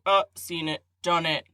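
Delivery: usual speaking voice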